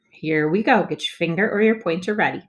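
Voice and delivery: usual speaking voice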